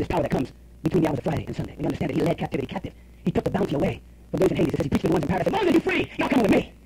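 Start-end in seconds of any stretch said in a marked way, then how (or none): 5.5-6.7 lightly acted liberator calling his people; a hint of a summoning shout. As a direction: pitch jumps up on a rallying call. Jesus calls out to captives.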